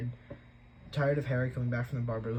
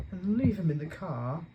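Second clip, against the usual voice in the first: slow, higher reluctant plan; slow musing aunt; 7 semitones higher, 4 dB louder than usual. Acted. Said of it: "said Aunt Petunia slowly".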